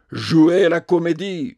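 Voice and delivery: howling. A narrator's reading. Tragedian howling the lady's accusation back at her. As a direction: fling the words back, howling. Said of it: "hurla le tragédien".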